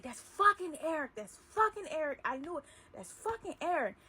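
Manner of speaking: furious recognition. Retold or imitated. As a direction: spit the name out in fury. Boss recognises his wife's lover.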